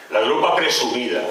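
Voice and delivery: usual speaking voice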